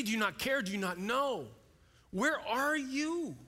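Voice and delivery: imagined desperate cry, pitch climbing as the questions pile up; anguished plea. Acted. Voicing disciples' panicked prayers in storm.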